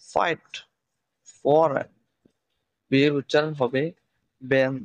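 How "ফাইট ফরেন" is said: "ফাইট, ফরেন" starting with lips brought together; lip-touched F. Shown as a demonstration. Teacher giving F examples, lip-made start on "ফাইট, ফরেন". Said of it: "উপরের ঠোঁটের সাথে নিচের ঠোঁটে স্পর্শ".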